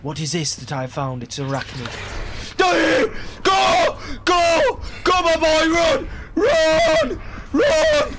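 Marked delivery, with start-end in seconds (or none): 2.6-8.2 high, full-volume panicked yells; frantic shouting. Real, not acted. Player urging his character to run, frantic shouting. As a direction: scream urgent orders to flee. Fleeing a monster in game.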